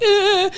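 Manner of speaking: high, loud frustrated ugh; upset groan. Retold or imitated. Storming off stage upset.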